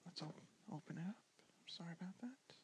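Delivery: ordinary talk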